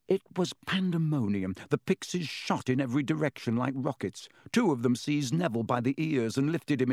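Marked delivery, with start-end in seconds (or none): none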